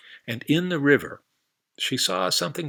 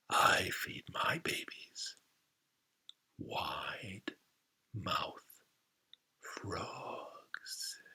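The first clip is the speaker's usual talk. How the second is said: very soft, slow whispered threat; menacing alligator whisper; 4 semitones lower, 11 dB quieter, much slower than usual. Acted. Alligator whispering to the frog, slow and menacing. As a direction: let it drip with menace.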